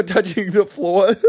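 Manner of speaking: ordinary talk, nothing marked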